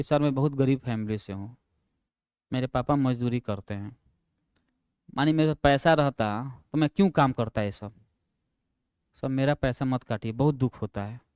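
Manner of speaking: quiet, mournful begging put on for the boss; sad plea. Teacher pleading with HR boss not to cut his pay, mock sorrowful. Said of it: "दुख के साथ बोला".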